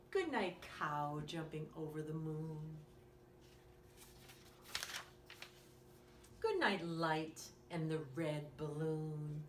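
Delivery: put-on lively bedtime story read to a small child; reading to children. Reading a bedtime story to young listeners, lively and gentle. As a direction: read it like a bedtime picture book. Reads picture book aloud.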